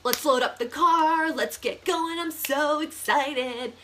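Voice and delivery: eager, bouncy John voice, slowed and sing-song; sing-song excitement, drawn out; excited John. Retold or imitated. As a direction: play John raring to hit the road. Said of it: "John is ready".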